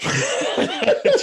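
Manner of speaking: high squeaky burst of laughter; high squealing laugh. Real, not acted. Laugh during intro.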